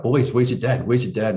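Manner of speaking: retold calling from the kitchen; imitated yelling. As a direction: call out like a wife looking for dad. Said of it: "she's just yelling out".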